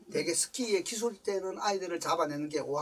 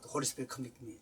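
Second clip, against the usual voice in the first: humble confession; 6 semitones lower, 13 dB quieter, much slower than usual. Retold or imitated. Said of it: "성령님 나를 책망하는데".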